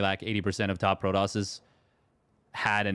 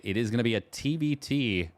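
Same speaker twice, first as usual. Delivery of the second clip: ordinary talk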